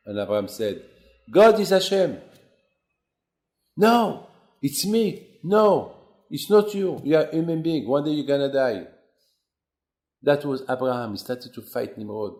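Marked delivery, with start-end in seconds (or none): 1.3-2.2 higher and louder, firm; defiant declaration. Acted. Abraham answering King Nimrod: a loud, defiant declaration. 3.8-8.9 higher and louder, heated back and forth; heated argument. Acted. Nimrod and Abraham quarrelling over who is God: loud and heated.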